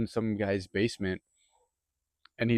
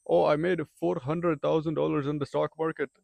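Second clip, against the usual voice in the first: higher, put-on Indian accent, boastful; Indian accent impression; 6 semitones higher than usual. Coworker bragging about stock gains, imitated Indian accent. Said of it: "Sorry if my accent's bad".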